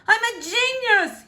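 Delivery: high delighted squeal. A narrator's reading. King admiring his palace, squealing with glee. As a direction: squeal it like a pleased little king. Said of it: "he squealed with a clap of his hands".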